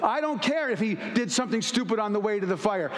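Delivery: usual speaking voice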